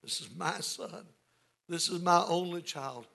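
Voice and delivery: lightly voiced father, softly pleading; a hushed hint of a father's appeal. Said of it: "You hear that in the father's voice".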